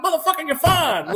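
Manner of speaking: high retold holler; exasperated shout. Team leader answering wounded Marine, high exasperated holler. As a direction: shout it back in mock exasperation.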